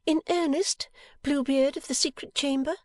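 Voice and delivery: high-pitched teasing question; Bella, brightened wife. A narrator's reading. Wife teases husband as she stops pouting, playful. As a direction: ask it playfully, pitched far up. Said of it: "clearing her pretty face".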